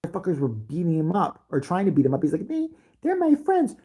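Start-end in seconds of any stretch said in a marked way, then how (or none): 2.5-3.8 put-on high, slow childlike voice; a small boy defending his bullies; naive little kid.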